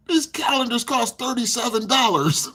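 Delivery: high, slow put-on clerk voice; mock cashier announcing the price. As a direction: mimic the store clerk, drawn out and high. Cashier voiced in retold story.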